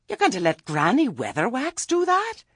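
high, loud disbelieving question; incredulous. A narrator's reading. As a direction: question it in astonishment, pitch leaping up. Nanny cannot believe the plan.

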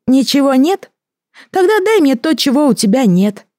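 very high, sly creature voice; gurgling alien voice. Acted. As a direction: pose the riddle as a strange creature. Creature's riddling demand.

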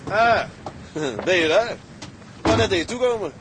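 high, loud surprised greeting; startled to see her standing there. Acted. Man startled to find the girl up and dressed, high loud surprise.

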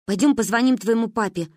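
ordinary delivery

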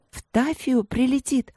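put-on high-pitched girlish delight. A narrator's reading. Princess hears her sister is coming, delighted. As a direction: exclaim it high and happily. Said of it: "обрадовалась Каролина".

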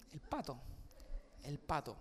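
very soft, low warning; hushed threat. Acted. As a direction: mouth it as quiet blackmail. Hermana chantajea a Pedrito en silencio. (amenaza muy baja y callada)

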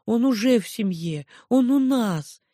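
put-on high childish protest; cling on in a child's voice; little girl pleading. A narrator's reading. (детский протест Миуши)

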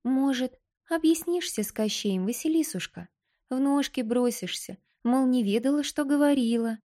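tiny doll, calmly correcting; too calm creepy doll. Acted. Doll speaks.

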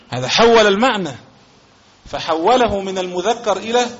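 usual speaking voice

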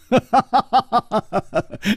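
high, staccato ha-ha laugh; triumphant laugh. Acted. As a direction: burst into high gloating peals. Detective gloats over the proof.

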